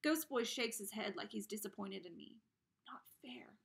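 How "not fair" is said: soft unvoiced whisper; inner thought. A narrator's reading. Boy thinks it silently, whispered. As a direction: keep it inside your head. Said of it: "I think".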